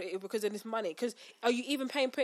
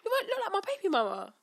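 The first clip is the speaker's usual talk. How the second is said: mocking the cocky customer; 12 semitones higher than usual. Cashier mocking the customer's confident line, high put-on voice. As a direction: send up his swagger.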